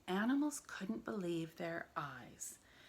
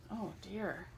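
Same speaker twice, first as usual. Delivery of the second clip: usual speaking voice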